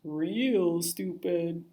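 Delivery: slow odd fish voice. Acted. Teasing fish voice.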